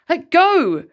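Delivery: put-on sharp high yell of a boy losing patience; boy shouting over a torn comic; angry yell. A narrator's reading. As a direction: snap it out, loud and high. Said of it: "repeated Bill Simpson".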